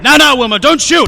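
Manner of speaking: frantic high yell begging him to stop; panicked shout. Acted. Gutman pleads, gun drawn.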